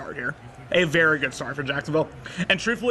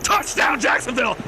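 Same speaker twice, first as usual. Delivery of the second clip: 1 touchdown yell; 7 semitones higher, 3 dB louder than usual. Real, not acted. Touchdown celebration.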